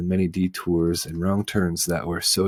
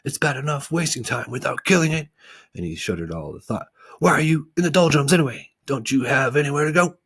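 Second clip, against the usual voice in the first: stern watchdog; 8 semitones higher, 5 dB louder than usual. Acted. Watchdog scolding.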